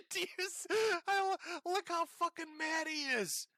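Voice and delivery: helpless laughter breaking up the words; laughing. Real, not acted. Host mocking an angry man's rant, laughing. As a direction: talk high through real laughter.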